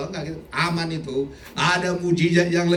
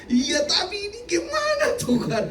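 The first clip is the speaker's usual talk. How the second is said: much higher, whiny crying plea; half-joking sob to God; mock whimper. Preacher mock-crying to God about his liver. Retelling panic about illness.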